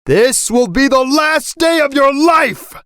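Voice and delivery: high-pitched furious threat; enraged dad, acted at full pitch; enraged scream. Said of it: "he started screaming".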